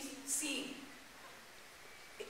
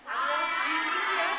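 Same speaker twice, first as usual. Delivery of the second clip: loud teasing call; 3 semitones higher, 9 dB louder than usual. Real, not acted. Teacher rousing quiet kids.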